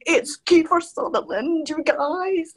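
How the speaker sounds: high, drawn-out starstruck squeal; mock fan losing all composure; losing cool. Fan telling work table who is behind her, losing her cool. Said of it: "I just lose my cool".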